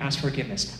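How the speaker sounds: ordinary delivery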